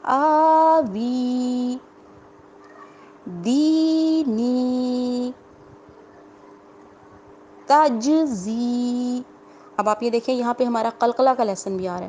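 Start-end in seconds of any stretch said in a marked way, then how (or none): 0.0-9.2 آوی, دینی and تجزی with long vowels held slowly; drawn out vowels. Shown as a demonstration. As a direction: recite each word with full lengthening. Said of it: "کھینچ کر پڑھتے ہیں".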